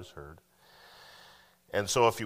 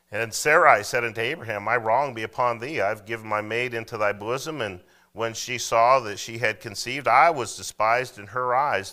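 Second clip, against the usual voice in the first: full-voiced King James reading, a little louder; scripture reading; 2 semitones higher, 6 dB louder than usual. Pastor reading Genesis to the church, loud and measured. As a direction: read the verse out to the congregation. Preacher reads scripture aloud.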